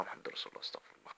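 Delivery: a soft, breathy whisper to himself; whispered prayer. Real, not acted. Calming himself down after thinking about things, in a soft whisper. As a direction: whisper the words to calm yourself down.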